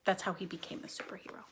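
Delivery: fast stage whisper. A narrator's reading. Teacher reading aloud, confiding the origin in a fast whisper. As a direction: let the secret slip out in a quick whisper. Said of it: "unvoiced whisper".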